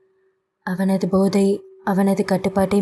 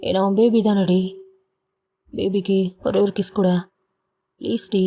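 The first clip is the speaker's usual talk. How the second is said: put-on drunken pleading. A narrator's reading. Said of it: "Varun போதையில் கெஞ்சிக் கொண்டிருக்க".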